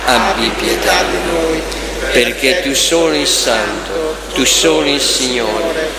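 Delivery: ordinary talk, nothing marked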